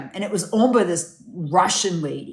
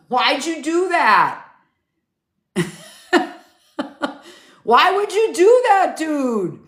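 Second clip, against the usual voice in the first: surprised; 7 semitones higher than usual. Real, not acted. Reacting to viewer's shocking comment.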